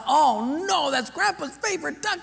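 put-on high, strained child's panic; panicked boy. Boy sees grandpa's duck dead, high panic. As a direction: yelp it like a scared kid.

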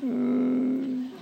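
high, soft, held hum; uneasy hum. Acted. Debtor reminded of the contract, humming uneasily. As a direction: hum instead of answering.

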